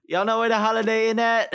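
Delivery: high, fast silly guy voice; guy's voice. Retold or imitated. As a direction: squeak out the question fast. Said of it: "some guy goes like".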